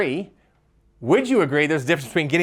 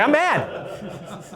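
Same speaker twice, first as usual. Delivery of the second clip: ordinary talk, nothing marked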